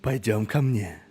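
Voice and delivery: flirt in a put-on sultry voice; hot voice. Shown as a demonstration. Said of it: "hot voice".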